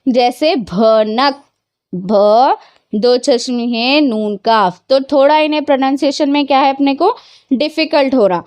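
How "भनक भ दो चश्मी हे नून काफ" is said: slow, spelled out letter by letter; teacher spelling out भनक; aspirated bh demo. Shown as a demonstration. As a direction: say भनक with a breathy bh, then spell it slowly. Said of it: "दो चश्मी हे से मिलकर बनने वाला अल्फाज".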